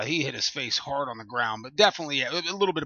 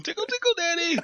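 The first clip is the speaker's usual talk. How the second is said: put-on very high, playful toddler voice; imitated two-year-old. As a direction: squeal it like a toddler at a tickle game. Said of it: "I had a two-year-old out there playing".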